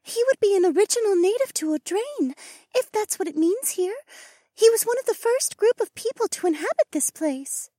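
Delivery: very high, gentle-toned female creature voice; bird companion. Acted. Bird companion explains tribals to her lord, high voice. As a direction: speak high and deferential to your lord.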